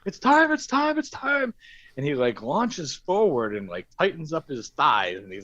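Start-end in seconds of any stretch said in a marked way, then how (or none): 0.0-1.5 frantic high-pitched urgency; frantic mock squeal; urgent high cry. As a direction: squeal it out like he can't wait. Boyfriend urgently signals in story.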